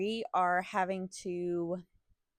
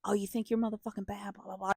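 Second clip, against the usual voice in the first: ordinary talk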